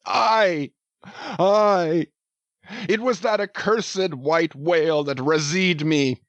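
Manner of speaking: put-on half howl, half sob of a wounded old captain; sobbing shout. A narrator's reading. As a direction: heartbroken old captain crying out, understated. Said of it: "he shouted with a terrific, loud, animal sob".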